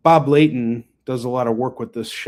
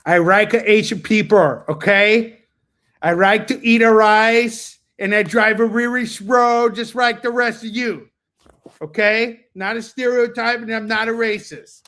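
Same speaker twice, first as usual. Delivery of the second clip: louder, higher sarcastic put-on voice; 8 semitones higher, 6 dB louder than usual. Seller jokes to his viewers in a louder, higher sarcastic put-on voice. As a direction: lay the sarcasm on thick, a touch of caricature.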